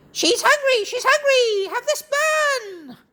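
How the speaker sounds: very high, squawky parrot voice; excited parrot. Acted. Parrot offers a bun.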